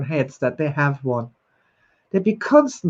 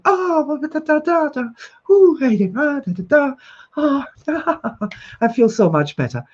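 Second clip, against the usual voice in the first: high, bubbly, gushing recollection; happy memory demo; 6 semitones higher than usual. Shown as a demonstration. Host demonstrating a happy memory to viewers, bubbly and gushing. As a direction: act out someone glowing over a happy memory. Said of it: "see the difference between happy and sad".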